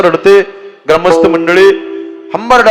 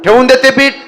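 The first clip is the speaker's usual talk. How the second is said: higher sharp scold; mother scolding; 8 semitones higher, 4 dB louder than usual. Acted. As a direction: snap at the child.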